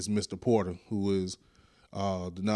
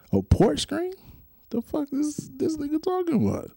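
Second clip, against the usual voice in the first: Pat's baffled voice. Retold or imitated. Host voices Pat mocking the mangled title, high and baffled. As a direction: play Pat, squeaking in disbelief at the botched name. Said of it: "I am, Pat, right now, I am your conscience".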